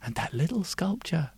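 usual speaking voice

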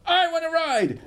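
high, loud eager demand; bold girl. Acted. Brave girl demanding the roundabout, high loud shout. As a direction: shove forward high and loud. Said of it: "she'd go".

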